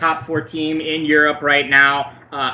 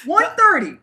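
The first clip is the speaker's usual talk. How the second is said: stunned; 12 semitones higher than usual. Real, not acted. Shocked echo of odds.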